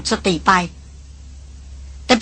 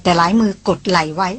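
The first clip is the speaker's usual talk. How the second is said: plain reading voice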